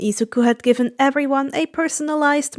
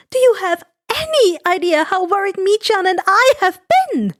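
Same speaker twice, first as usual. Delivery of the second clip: high scolding; 7 semitones higher than usual. Acted. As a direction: scold him, worried sick. Worried mother scolds.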